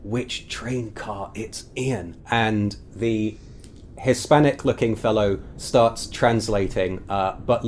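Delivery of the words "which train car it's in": urgent whisper between thugs. Acted. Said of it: "ponytailed guy is saying".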